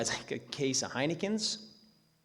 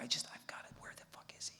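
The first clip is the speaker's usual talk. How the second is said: frantic thought in a bare whisper; hushed searching; 16 dB quieter, faster than usual. Acted. Teen hunting the dog in a silent house, whispered. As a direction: whisper so the house stays asleep.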